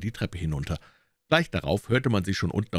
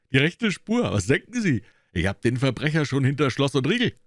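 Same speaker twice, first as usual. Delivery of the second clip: much higher and louder, smug scoff; pompous detective; 8 semitones higher, 3 dB louder than usual. A narrator's reading. Gregson scoffing at Holmes's doubt. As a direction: scoff at the doubt, full of yourself.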